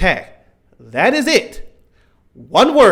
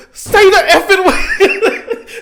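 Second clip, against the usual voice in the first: much higher, louder, slow and laughing; half-laughing angry shout at the screen; mad yell; 12 semitones higher, 5 dB louder, much slower than usual. Retold or imitated. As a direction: drag out a furious laughing demand at the TV. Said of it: "Like, I was so mad".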